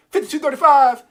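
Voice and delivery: loud, high, hyped-up shout of the price. Real, not acted. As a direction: yell the number like you can't believe it. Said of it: "I'm getting a little too hype".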